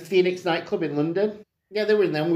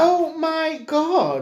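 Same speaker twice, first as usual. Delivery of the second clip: exasperated groan; 12 semitones higher, much slower than usual. Real, not acted. Exasperated at football talk.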